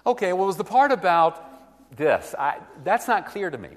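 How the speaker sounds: lightly acted listener pointing out a gap; voiced objection. Shown as a demonstration. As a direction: play the person who is confused.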